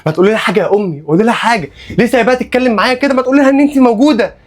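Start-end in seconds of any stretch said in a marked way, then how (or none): 2.0-4.4 building from fast pleading to a high cry; rising desperate outburst. Acted. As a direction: climb up until you're crying out. Patient spirals into a breakdown. (انفعال متصاعد ينتهي بصرخة عالية)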